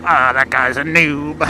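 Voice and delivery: slow, drawn-out sneering mimicry; mocking voice. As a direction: imitate a condescending bystander. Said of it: "and she's like".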